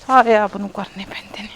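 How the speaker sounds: whispered aside under the breath; annoyed whisper nobody should hear; whispered grumble. Acted. Muttered aside while carrying onions.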